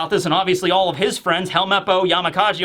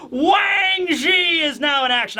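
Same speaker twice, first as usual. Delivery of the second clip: hyped shout; 8 semitones higher, slower than usual. Real, not acted. Fan hyping that Wang Zhi is now in action. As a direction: shout the news with glee.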